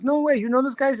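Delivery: high, loud imitated disbelief; retold amazement. Retold Prince reacting to famous names.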